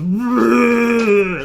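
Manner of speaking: loud, drawn-out roaring growl; monster growl. Acted. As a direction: let out a long, loud creature growl. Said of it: "deep growl".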